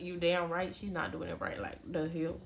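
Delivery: hushed agreement. Retold or imitated. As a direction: agree quietly so the stylist won't hear. Agreeing privately with friend.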